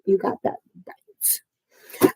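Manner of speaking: slow, drawn-out whisper; whispered agreement. Real, not acted. Streamer agreeing with a viewer in the chat, whispered slowly. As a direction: whisper it slowly, stretching each word. Same words repeated in a whisper.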